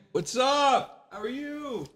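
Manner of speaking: high-pitched far-off call; calling from afar. Pretend. Man showing off away from the microphone, high distant calling. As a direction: call out high from across the room. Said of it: "Do the whole show like this".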